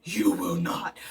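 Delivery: very deep, firm "You will not"; stern refusal. Retold or imitated. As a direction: drop into a low, stern voice to refuse. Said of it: "and I'm like".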